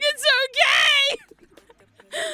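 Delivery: very high, loud, stretched-out mock bawl; crying reassurance. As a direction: bawl out comfort through hammed-up tears. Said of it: "and I'm crying".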